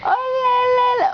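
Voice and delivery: put-on high, singsong baby talk. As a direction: coo playfully at the baby. Grandpa coos to baby.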